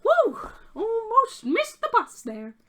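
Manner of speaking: high, loud goblin relief. Acted. Goblin settling into his seat, high and relieved. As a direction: puff out a squeaky relieved woo. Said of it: "and he goes".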